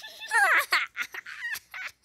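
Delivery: shrieking cry-laugh; high wheezing laughter fit; helpless high laugh. Real, not acted. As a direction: lose it completely, loud and high. Cracks up at kid's answer.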